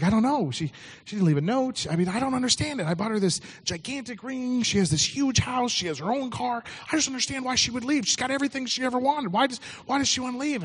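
high, pleading panic; husband pleading to know why his wife left, high and panicked; panicked friend. Retold or imitated.

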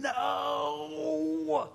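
an apostle's pained outcry at his churches; Paul pleading. Acted. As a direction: cry out to the churches in dismay. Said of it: "he's saying".

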